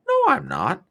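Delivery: high, loud mock retort; playing the clueless one; mock denial. Imagined naive person answering, high mock denial. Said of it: "acted quarrel with naive person".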